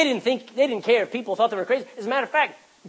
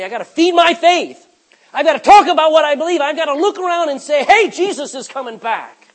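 loud, higher, urgent proclamation; impassioned declaration; 6 semitones higher, 9 dB louder than usual. Acted. Believer speaking up at work about faith, loud and impassioned. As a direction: burst out with it, unable to hold faith in. Acting out bold witness at work.

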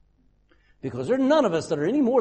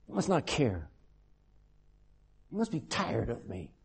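sinking softer and lower, giving up; defeated despair; 6 semitones lower, 8 dB quieter than usual. Retold or imitated. Job giving up on God, soft and defeated. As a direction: let the plea collapse into resignation. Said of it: "Can you imagine him saying that?".

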